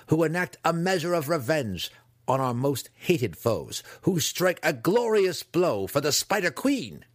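zealous. A narrator's reading. Dinin exults over the raid.

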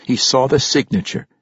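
ordinary delivery

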